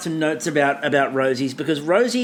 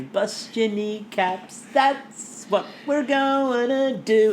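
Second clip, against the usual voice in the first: tough-guy threat; 4 semitones higher, much slower than usual. Pretend. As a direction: lean in and threaten slowly. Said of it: "I'm getting very pomplimous here".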